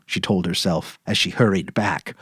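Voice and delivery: usual speaking voice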